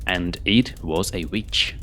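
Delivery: ordinary delivery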